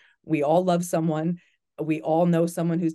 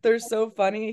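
usual speaking voice

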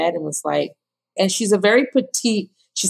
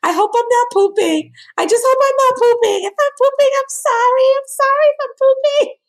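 high, loud panicked apology; imitating her own panicked self in labour; 4 dB louder than usual. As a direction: squeal anxious apologies mid-push. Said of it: "I do remember being like".

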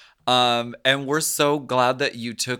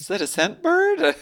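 squealing pun; 12 semitones higher than usual. Pretend. Joke after a cave bird.

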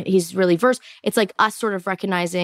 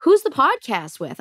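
husband asking; 10 semitones higher than usual. Retold or imitated. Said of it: "'cause he goes".

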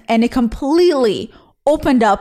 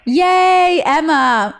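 high, drawn-out happy cheer; excited cheer; 6 semitones higher, 3 dB louder, much slower than usual. Real, not acted. Host welcoming a listener by name, excited and high.

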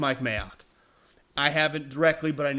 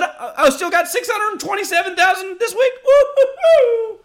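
gleeful rich athlete; 8 dB louder than usual. Pretend. Pretending to be the athlete after taxes, whooping with glee. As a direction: cheer like a millionaire who kept most of his check.

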